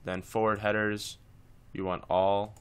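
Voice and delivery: ordinary talk, nothing marked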